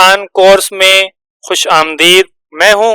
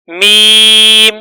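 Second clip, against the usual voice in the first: slow, stretched letter name; drawn-out letter name; much slower than usual. Shown as a demonstration. Teacher drawing out the letter name for students. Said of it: "ہم میم کی آواز بنائیں گے".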